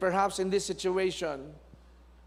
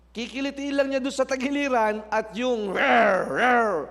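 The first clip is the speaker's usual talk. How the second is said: dog growl; 6 semitones higher, 6 dB louder than usual. Retold or imitated. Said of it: "yung galit na aso".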